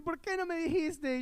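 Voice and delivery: high-pitched imitation of a weeping mother; mock crying. Mother asking son after his confession, mock crying. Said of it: "viene llorando".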